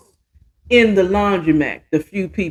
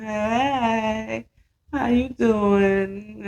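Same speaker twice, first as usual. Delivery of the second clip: phony greeting; 2 semitones higher, 7 dB quieter, much slower than usual. Retold or imitated. Charisse greeting Alicia with a phony smile, slow and sweet. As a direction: fake sweet, slow hello. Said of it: "phony smile".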